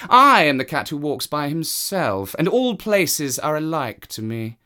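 a cat who answers to no one; haughty cat. Acted. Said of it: "said the cat".

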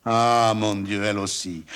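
a loud, dismayed outburst; stunned cry. A narrator's reading. Monsieur Seguin learning his goat wants to leave, crying out stunned. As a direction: cry out in disbelief. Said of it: "cria monsieur Seguin stupéfait".

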